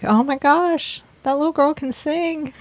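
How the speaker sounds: excited gushing. Retold or imitated. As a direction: gush over her like a thrilled fan. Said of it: "I was like".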